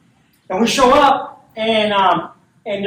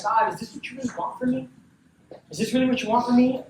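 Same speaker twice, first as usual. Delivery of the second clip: soft, higher, hushed plea to God; quiet prayer; 3 semitones higher, 5 dB quieter than usual. Retold or imitated. Alone outside at the workshop, asking God, a soft hushed prayer. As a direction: pray it under your breath.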